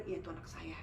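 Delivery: low, soft retold reply; quietly voiced, under the breath; hushed confirmation. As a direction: murmur the mother's answer. Mother nods and answers quietly.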